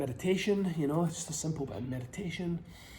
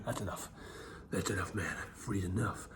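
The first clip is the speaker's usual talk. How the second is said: ordinary talk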